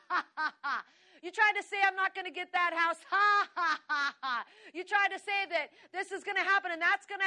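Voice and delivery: high, loud taunts with staged laughs; mock the accuser with booming laughter; defiant laughing. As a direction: scoff loudly, bursting into big "ha ha"s. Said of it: "you just gotta go".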